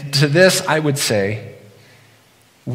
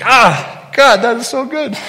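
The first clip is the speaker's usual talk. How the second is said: much higher and louder rapturous cry; ecstatic delight; 11 semitones higher, 8 dB louder, slower than usual. Retold or imitated. Preacher reliving the Reuben that sent him to heaven, rapturous. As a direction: swoon over the best sandwich ever. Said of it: "I'm like".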